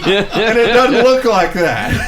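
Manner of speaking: high, loud laughing protest; laughing indignation. Real, not acted. A Maryland native laughing at the game, indignant.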